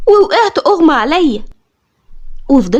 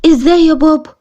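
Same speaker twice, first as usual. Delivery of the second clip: mildly astonished question; a touch of bewilderment; 2 semitones higher than usual. Acted. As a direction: ask dad in mild disbelief. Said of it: "بصيت لبابا باستغراب وقلت له".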